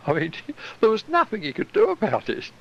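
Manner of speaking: laughing. Real, not acted. Still laughing at the story.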